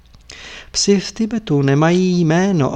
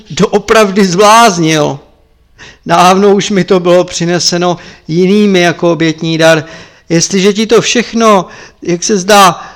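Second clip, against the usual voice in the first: stern rebuking Lama; 3 semitones higher, 8 dB louder than usual. Acted. Lama berates monk Tepaga and sends him away, stern. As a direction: drive the monk out with contempt.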